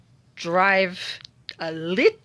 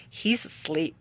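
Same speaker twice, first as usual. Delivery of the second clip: whisper; 4 semitones lower, 5 dB quieter than usual. Real, not acted. Kidney stone personified sleeping.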